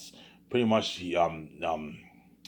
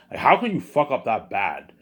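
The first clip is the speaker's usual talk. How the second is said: louder, disbelieving outburst; exasperated rant; 4 semitones higher, 6 dB louder than usual. Real, not acted. Fan venting about a ruined sequel, exasperated outburst. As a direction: throw your hands up at how bad it was.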